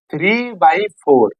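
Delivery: three by four drawn out word by word; slow, spelled-out reading. Shown as a demonstration. Teacher repeating three by four for students, slowly. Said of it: "इसको बारह नहीं पढ़ेंगे".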